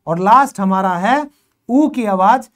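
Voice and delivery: ordinary talk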